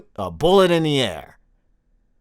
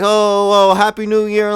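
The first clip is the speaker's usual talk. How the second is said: loud, higher mock stage cheer; hyped local band; 4 semitones higher, 5 dB louder than usual. Local band greets hometown crowd at New Year, mock hype. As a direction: hail the crowd like an unknown band. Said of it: "repping the city like".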